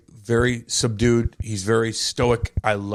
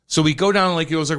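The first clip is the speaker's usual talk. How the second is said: ordinary talk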